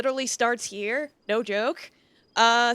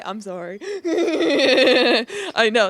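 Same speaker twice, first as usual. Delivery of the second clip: ordinary delivery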